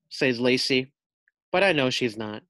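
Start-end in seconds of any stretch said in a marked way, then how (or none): none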